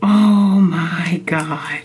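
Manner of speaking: awed. Real, not acted. Unboxing, first sight of Kindle.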